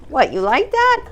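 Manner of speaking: very high sing-song to the dog; high squeaky treat tease for the dog. Real, not acted. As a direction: tempt him with a squeaky treat voice. Dog enjoying peanut butter.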